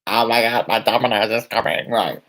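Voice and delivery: ordinary talk, nothing marked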